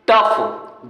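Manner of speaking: टफ ending in an F sound. Shown as a demonstration. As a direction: say टफ with final GH pronounced "f". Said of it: "जी H को फा पढ़ रहे हैं". (टफ, अंत में फ़ की आवाज़)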